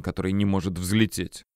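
plain reading voice

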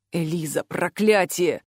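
low man's voice, exasperated; anguished Alaris. A narrator's reading. Alaris sees Eliza fallen on the arena, low anguish. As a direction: curse low and helplessly.

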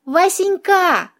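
high, drawn-out coaxing call; wheedling singsong; ingratiating purr. A narrator's reading. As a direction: purr it sweetly and slowly, angling for an answer. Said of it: "вкрадчиво промурлыкала я".

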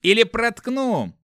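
high, loud, drawn-out threat; barked threat. A narrator's reading. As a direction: bark a warning with blade drawn. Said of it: "рявкнул страж".

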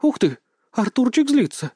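high-pitched, thrilled wonder; impressed, lilting exclamation; admiring delight. A narrator's reading. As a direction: marvel at it with delight. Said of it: "с восхищением выдохнула она".

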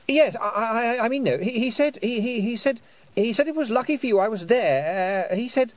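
high, stammering, repeated false starts; flustered boy. A narrator's reading. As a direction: trip over your words in agitation.

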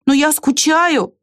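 high, drawn-out cry; plaintive call. A narrator's reading. Squirrel insists to the departing ant, calling out.

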